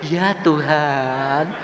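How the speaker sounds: slow, drawn-out reply with rising end; imitated slow, submissive address; reverent answer. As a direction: stretch it out devoutly to the Lord. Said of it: "Setan tak panggil".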